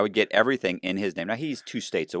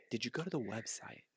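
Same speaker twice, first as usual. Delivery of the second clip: very soft, careful question; tentative probing question; 3 semitones higher, 18 dB quieter than usual. Retold or imitated. Teller retelling his question to the agent, softly probing. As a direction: ask it gingerly, barely aloud.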